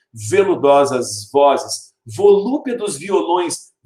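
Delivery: slow, leaning on each V in "Veludosas vozes, volúpedos violões"; stressed alliteration. Shown as a demonstration. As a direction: hit the V sound of every word, slowly. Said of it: "Eu vou acentuar um pouquinho pra vocês sentirem".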